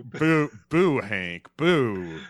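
loud mock boo at Hank; playful booing. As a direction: jeer like a panto crowd.

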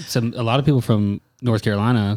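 ordinary talk, nothing marked

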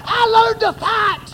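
shouted, high, slow preacher's cry; preaching at full force. Acted. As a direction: thunder it to the congregation like a revival.

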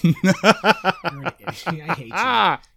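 slow, affectionate jab through real laughter; laughing insult. Real, not acted. Podcaster to co-host after a silly joke, laughing insult. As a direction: say it while cracking up.

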